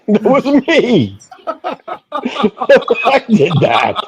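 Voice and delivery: laughing through the words, slow and high. Real, not acted. Friend laughs while admitting the prank. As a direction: laugh out the confession. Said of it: "laughing claim".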